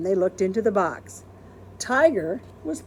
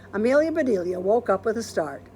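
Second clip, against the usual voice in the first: ordinary delivery, nothing marked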